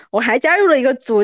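ordinary talk

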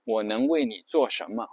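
ordinary delivery

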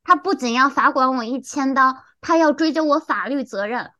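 ordinary talk, nothing marked

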